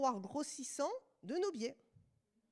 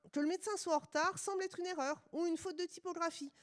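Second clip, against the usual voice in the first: ordinary delivery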